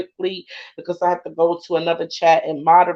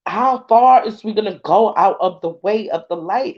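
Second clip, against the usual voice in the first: ordinary delivery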